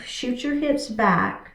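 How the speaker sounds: usual speaking voice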